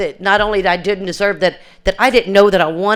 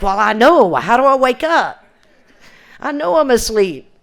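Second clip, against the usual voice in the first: higher, louder, pleading with rising frustration; exasperated plea; 6 semitones higher, 4 dB louder than usual. Retold or imitated. To her sponsor, a desperate exasperated plea. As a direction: cry out I know, how do I wake up.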